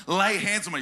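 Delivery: normal talking voice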